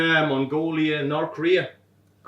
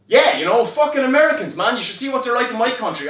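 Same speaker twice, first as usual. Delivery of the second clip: loud, high, eager agreement; forced bravado; 8 semitones higher, 9 dB louder than usual. Retold or imitated. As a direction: overplay the matey enthusiasm. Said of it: "I just need to rally".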